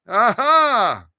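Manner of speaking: ordinary talk, nothing marked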